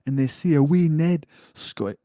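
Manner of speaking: high-pitched mock Scottish; attempted Scottish accent. Host reads the neighbour story in a high, mock Scottish accent. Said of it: "Scottish thing to say".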